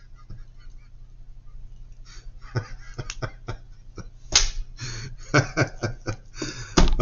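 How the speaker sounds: high breathy burst of laughter; long amused laugh; real laugh. Real, not acted. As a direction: laugh out at your own joke. Laughs at her own remark.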